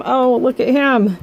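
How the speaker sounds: plain reading voice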